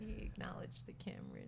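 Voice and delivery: quiet whispered aside. Real, not acted. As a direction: whisper it so the dog won't hear. Aside while filming.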